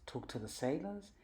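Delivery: plain reading voice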